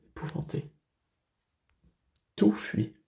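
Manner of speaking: hushed, voiceless horror; effroi étouffé. Acted. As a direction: let the voice drop to a horrified whisper. Horror at the monster, voice drops. (souffle épouvanté, presque chuchoté)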